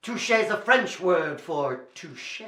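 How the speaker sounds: ordinary delivery, nothing marked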